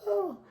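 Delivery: very high retold cry of grief; short mock wailing "Oh"; imitated wail. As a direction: mimic mom's anguished high wail. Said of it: "Got the crying, screaming".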